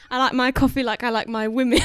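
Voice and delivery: loud mock British, amused; British accent. Shown as a demonstration. Guest joking in the demo, loud British accent. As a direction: deliver it in a put-on British voice. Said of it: "Let's hear a British accent".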